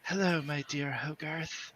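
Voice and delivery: soft breathy whisper close to the ear. Acted. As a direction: breathe it softly into her ear. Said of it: "he whispers softly in your ear".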